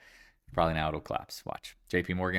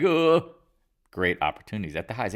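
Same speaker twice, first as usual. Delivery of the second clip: mock enthusiasm; 4 semitones higher, 8 dB louder than usual. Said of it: "Now you're like".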